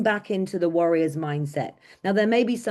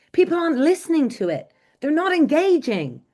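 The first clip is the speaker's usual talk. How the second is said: excited; 9 semitones higher, 3 dB louder than usual. Real, not acted. Passionate point about boring speakers.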